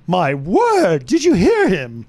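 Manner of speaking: slow, lightly posh exclamation; hammed-up shocked aristocrat; mock posh gasp.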